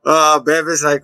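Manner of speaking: usual speaking voice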